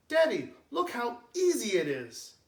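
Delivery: excited call to daddy; excited cry. Acted. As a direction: shout it proudly to dad. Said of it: "cries Jason".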